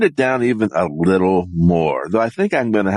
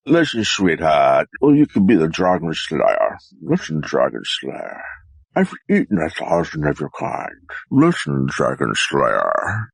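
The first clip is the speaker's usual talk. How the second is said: dragon; 5 semitones lower than usual. Acted. Dragon taunts the slayer, low and menacing. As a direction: play a dragon talking down to its hunter. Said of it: "do the dragon".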